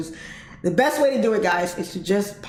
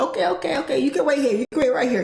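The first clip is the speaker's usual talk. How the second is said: lightly voiced valet lady, higher pitched; quick, high reassurance in a valet's voice; sweet valet lady; 6 semitones higher, faster than usual. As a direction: imitate an eager, obliging valet.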